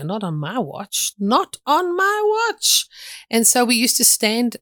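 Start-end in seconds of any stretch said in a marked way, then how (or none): 1.2-2.9 very high, drawn-out mock indignation; indignant vow. Speaker recalls vowing against the brothel on the street, indignant vow. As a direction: stretch each word and soar up in pitch.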